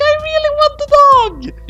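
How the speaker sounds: high, loud, slow wail; scream. Acted. Yotsuba screaming alone in space. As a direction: howl it out. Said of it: "Yotsuba screamed".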